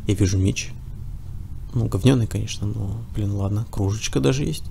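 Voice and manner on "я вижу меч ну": ordinary talk, nothing marked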